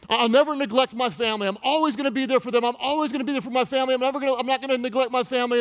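high, loud, rushed vows; frantic promising. Acted. Man swearing to be there for family, frantic promising. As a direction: rattle off insistent promises at full pitch. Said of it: "And right after you promise".

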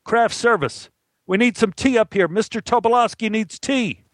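high-pitched yell across a film set. Acted. Assistant director calling craft services for tea, yelled. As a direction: call out an order to someone far off. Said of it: "And he yelled".

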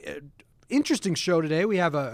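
ordinary delivery

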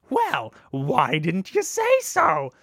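high, very drawn-out showy question; hammed-up, half-sung flourish; theatrical musical cue. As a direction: stretch it out like a song lead-in. Friends' musical cue to join in.

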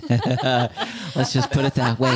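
slow, said through a laugh; real chuckle while talking. Real, not acted. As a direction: let the laugh carry the line. Laughing at own confession.

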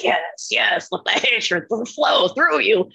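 slow, drawn-out villain impression; Emperor. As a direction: relish it like a gloating dark ruler. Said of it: "like the fucking emperor from Star Wars".

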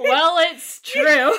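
slow words through laughter; laughing. Real, not acted. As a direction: laugh while you say it.

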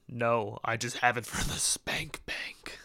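slow, breathy whispered confession; mock whisper. Playing the pissed-on guy confiding in a sly whisper. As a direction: whisper it like a sleazy secret. Imagined guy's sleazy reply.

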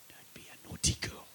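a whispered scold from a mother; hushed scolding. Retold or imitated. Mother whispering to her daughter at the airport, scolding. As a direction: hiss the warning under your breath.

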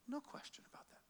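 hushed whispered repeat. Real, not acted. Preacher hushing to the congregation, whispered. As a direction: lean in and breathe it.

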